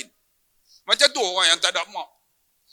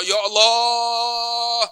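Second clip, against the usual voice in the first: long held call to God; praying aloud; 3 semitones higher, much slower than usual. Retold or imitated. Son retelling his prayer for his mother, long drawn-out invocation. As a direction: stretch the invocation out on a steady note. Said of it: "jadi kita pun doa".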